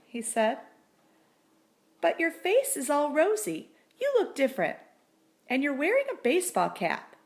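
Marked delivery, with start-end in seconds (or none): 2.0-7.2 higher, quicker wondering voice; amazed wife. A narrator's reading. Wife marvelling at her husband's rosy face.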